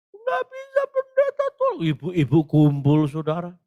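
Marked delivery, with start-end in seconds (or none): 0.1-1.8 shrieking high mock wail; imitated panicked pleading. Mother begs the pastor for help, imitated high cry. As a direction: mimic a frantic mother begging. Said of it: "mamanya bingung teriak".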